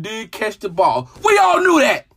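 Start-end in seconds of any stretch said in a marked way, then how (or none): 1.2-2.1 loud, high, indignant burst; outraged. Real, not acted. Fan answering a late admission of a bad call, outraged. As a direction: throw it out in exasperated protest.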